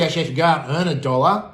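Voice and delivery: ordinary talk, nothing marked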